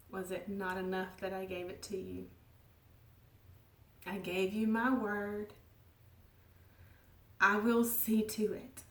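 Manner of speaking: soft, gentle voice of reassurance; gentle and loving. Acted. God gently reassuring her, soft and tender. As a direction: speak it tenderly, fading toward a whisper. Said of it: "ever so gently and lovingly said".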